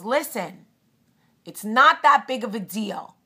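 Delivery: impatient New York broad voice, pushed out loud; fed-up mother with a thick Brooklyn accent speaks up loudly; brash Brooklyn accent. Retold or imitated. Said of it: "had a very heavy Long Island accent, Brooklyn".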